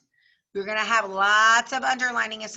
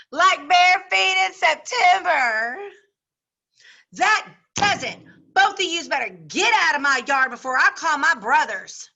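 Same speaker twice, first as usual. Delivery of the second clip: higher, louder taunt turning into a threat; insult and threat; 5 semitones higher, 6 dB louder than usual. Acted. Girl ordering rivals out of her yard, loud. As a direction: fling the insult, then order them out. Girls' quarrel acted in story.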